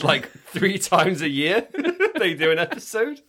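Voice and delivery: laughing through the words. Real, not acted. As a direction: giggle while you say it. Laughing at podcast's rare episodes.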